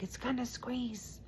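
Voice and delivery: put-on high, soft girlfriend voice; imitating girlfriend. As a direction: lightly voice her confident tip. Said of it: "she's like".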